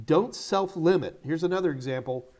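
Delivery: ordinary talk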